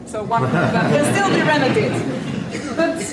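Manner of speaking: normal talking voice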